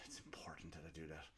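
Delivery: unvoiced whispered aside. Real, not acted. Podcaster whispering to himself about his phone. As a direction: breathe it to yourself. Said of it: "whispered aside".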